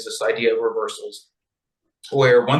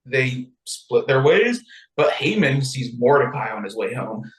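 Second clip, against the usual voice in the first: ordinary talk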